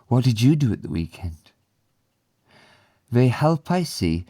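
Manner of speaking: dialogue read aloud. Acted. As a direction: read both parts like a textbook recording. Reads the lesson dialogue aloud.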